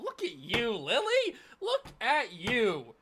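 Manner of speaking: high, loud, delighted cheering; excited praise. Real, not acted. Praising Lily for connecting the show's clues, excited. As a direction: cheer her on with a big stretched look at you.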